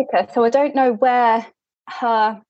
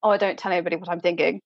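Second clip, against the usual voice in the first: a quick, quieter, mock-mysterious dismissal; fast airy brush-off; 5 semitones lower, 5 dB quieter, much faster than usual. Imitating Mrs Elton's quick, soft claim of mystery. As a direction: rush it, softer and airy. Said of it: "who's like".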